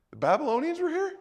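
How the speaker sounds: very high, quick, shocked question; can't believe what he did; alarmed question. Retold or imitated. Prophet confronts Hezekiah, shocked and high-pitched.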